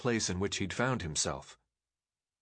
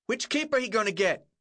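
much higher shout from the back; 12 semitones higher, 4 dB louder than usual. A narrator's reading. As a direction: yell it across a crowd. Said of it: "someone shouted from the back".

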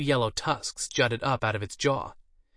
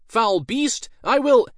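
loud and much higher, cut off; heroic battle cry; 13 semitones higher, 9 dB louder, slower than usual. A narrator's reading. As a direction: ham the hero's cry. Mage mid-battle cry.

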